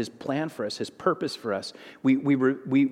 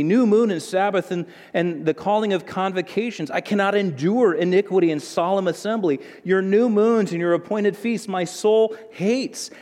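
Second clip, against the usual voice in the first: fiery, louder pulpit reading of God's complaint; preaching scripture; 5 semitones higher, 4 dB louder than usual. Acted. Preacher reading Isaiah to the congregation, full-force preaching. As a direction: thunder the prophet's words at the church.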